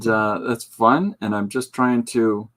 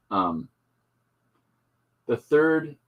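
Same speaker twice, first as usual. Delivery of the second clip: ordinary delivery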